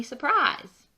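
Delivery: ordinary delivery, nothing marked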